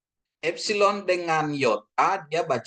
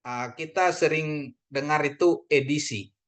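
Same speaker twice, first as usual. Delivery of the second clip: normal talking voice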